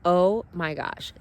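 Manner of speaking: ordinary talk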